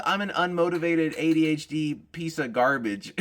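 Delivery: normal talking voice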